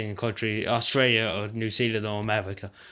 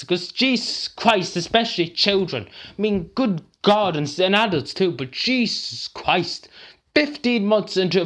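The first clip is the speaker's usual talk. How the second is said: exasperated anger; 7 semitones higher than usual. Real, not acted. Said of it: "I'm really just pissed".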